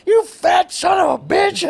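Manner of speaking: high, slow, stretched-out yell; imitated roasting shout. Counter woman roasting a customer at night, imitated shout. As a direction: bellow the insult like the counter woman.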